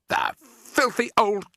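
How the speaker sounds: high, loud, drawn-out insult; outraged high-pitched yell; furious shout. A narrator's reading. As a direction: spit out each word slowly in outrage. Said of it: "he shouted".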